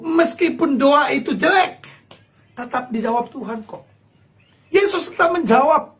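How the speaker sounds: ordinary talk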